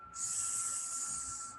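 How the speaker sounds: unvoiced hissing s; hissed s sound. Shown as a demonstration. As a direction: hiss the letter s. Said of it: "A bit longer".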